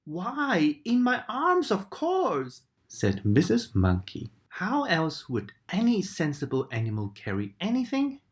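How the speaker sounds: a hint of a brisk monkey. A narrator's reading. Missus Monkey brushing off Katy, lightly voiced.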